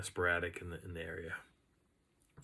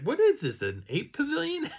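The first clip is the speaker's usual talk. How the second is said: high, incredulous outburst; amused disbelief; 5 dB louder than usual. Retold or imitated. Walker reacting to primate whoops in the forest, high disbelief. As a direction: pitch the question way up in baffled amusement.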